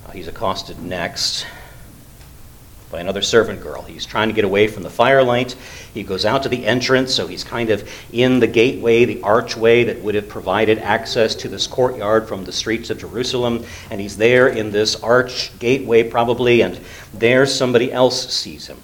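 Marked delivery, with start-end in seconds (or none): none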